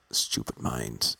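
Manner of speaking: ordinary delivery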